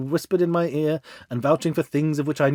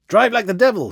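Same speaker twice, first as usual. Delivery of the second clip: loud, higher urgent command to the cabman; shouted order; 8 semitones higher, 5 dB louder than usual. A narrator's reading. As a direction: bark it at the driver in a hurry. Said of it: "he shouted".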